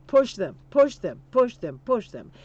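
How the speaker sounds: loud repeated exhortation, rising then falling; rallying cry. Real, not acted. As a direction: drive each "push them" home like a chant. Rallying crowd-style repetition.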